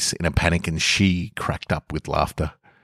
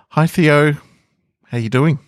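Hayley's voice; 9 semitones higher, 4 dB louder than usual. A narrator's reading. Hayley greets Theo at disco.

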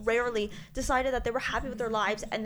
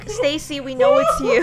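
"we know it's you" shouted louder and higher; mock shout; 4 semitones higher, 8 dB louder than usual. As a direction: shout it out laughing, like you caught her. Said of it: "they're like".

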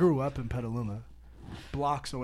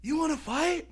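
high, louder mock challenge from a wasted frat boy; drunk frat boy; 14 semitones higher, 4 dB louder than usual. Mocking the blacked-out frat boy who wants to fight. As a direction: ham up the sloppy drunk picking a fight.

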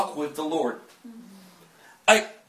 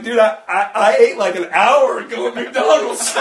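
louder, laughing through the words; laughing confession; 4 semitones higher, 6 dB louder than usual. Retold or imitated. Preacher telling friend Will he already ate, laughing loudly. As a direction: confess it while cracking up. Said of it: "I'm like".

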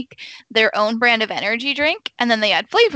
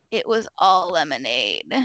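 low, slow sleepy mumble imitation; drowsy, drawn-out low retort; half asleep; 7 semitones lower, slower than usual. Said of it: "like, half asleep".

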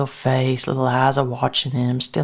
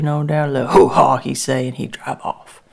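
usual speaking voice

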